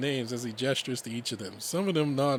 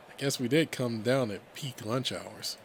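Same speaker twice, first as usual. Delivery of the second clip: usual speaking voice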